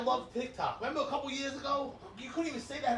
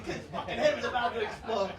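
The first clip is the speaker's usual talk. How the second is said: usual speaking voice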